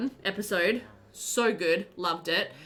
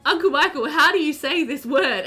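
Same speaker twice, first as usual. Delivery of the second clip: raised, louder retold question; puzzled question; 5 semitones higher, 6 dB louder than usual. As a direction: ask an uncle with mock bafflement. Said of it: "we're like".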